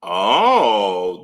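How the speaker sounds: ordinary talk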